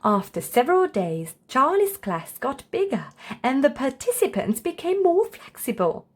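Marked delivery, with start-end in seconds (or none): none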